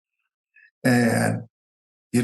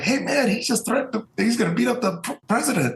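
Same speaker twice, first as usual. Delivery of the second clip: alarmed chief of staff; 12 semitones higher than usual. Retold or imitated. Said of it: "his chief of staff, Freddie".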